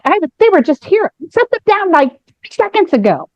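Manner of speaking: high, frantic disbelief; can't believe they've vanished; rising panic over lost drinks; alarmed disbelief. Retold or imitated. Panicking over missing champagne.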